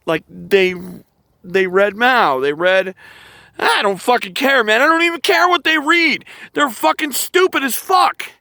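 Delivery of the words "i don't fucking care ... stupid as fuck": real fed-up anger, pitched high; exasperated outburst. Real, not acted. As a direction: burst out in heated, higher-pitched exasperation. Exasperated outburst in political rant.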